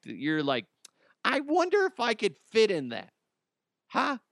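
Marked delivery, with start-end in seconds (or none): 1.2-4.2 high excited voice. Retold or imitated. Mock inner voice, then warning.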